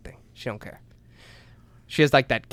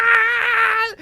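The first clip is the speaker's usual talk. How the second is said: laughing scream. Retold or imitated. Reliving the ride drop for friends, laughing scream. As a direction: scream half-laughing at the memory. Retelling the fall.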